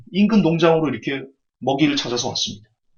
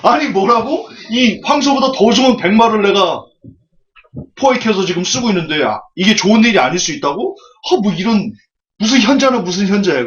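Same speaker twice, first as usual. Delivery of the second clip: higher and louder, indignant outburst; a farmer yelling at the old man; furious, disbelieving farmer; angry farmer; 6 semitones higher, 5 dB louder than usual. Acted. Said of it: "농부가 또 화가 났습니다".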